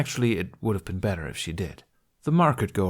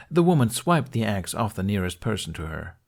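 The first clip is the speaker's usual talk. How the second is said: usual speaking voice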